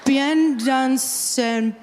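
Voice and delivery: "Bien dansé" very slow and careful in French; French pronunciation. Shown as a demonstration. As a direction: spell out "Bien dansé" slowly like a teacher. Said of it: "shirts upstairs that say".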